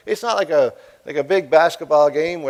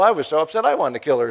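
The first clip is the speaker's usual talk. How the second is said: ordinary talk, nothing marked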